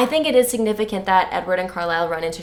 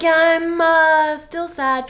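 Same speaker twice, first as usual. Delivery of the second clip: mopey Edward; 8 semitones higher, 3 dB louder, slower than usual. Retold or imitated. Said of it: "He's like".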